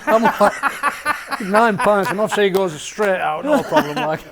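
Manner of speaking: amused, loud, laughing. Real, not acted. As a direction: tell it while cracking up. Laughing retelling Laura's nine pints.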